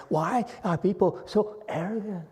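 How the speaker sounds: high-pitched, mock-innocent wondering; indirect Chinese-style scolding. Shown as a demonstration. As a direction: reproach them without addressing them, voice lifted high. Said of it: "He would not look at you in the eyes".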